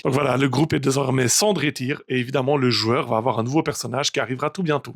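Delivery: soft outro address to viewers; channel outro. Acted. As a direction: wrap up the episode softly.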